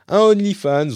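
high, loud mock surprise; mock surprised. Real, not acted. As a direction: blurt it out as a jokey shock. Joking reply to listener suggestion.

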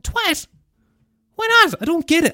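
high-pitched, squeaky disbelief; incredulous protest. Retold or imitated. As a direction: protest in exaggerated bafflement. Protesting at friends' verdict on word.

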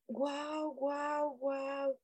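quiet, awed wows; soft awe. Real, not acted. Stunned by a viewer's comment, quietly awed.

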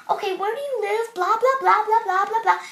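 dismissive, high blah-blah parody; mocked officer. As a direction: rattle off the officer's questions mockingly. Mocking the officer's questioning.

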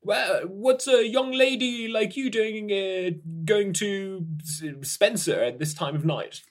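put-on curious old man chatting up a young lady; gentle elderly stranger on a train; kind old man.

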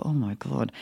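retold quiet "oh no" to oneself; hushed dismay. Actress to herself as phone buzzes mid-show, hushed. Reaction at buzzing phone in theater.

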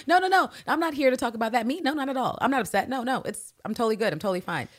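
high-pitched mock protest, settling toward the end; flustered denial. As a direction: insist too hard that all is well. Acted denial of being upset.

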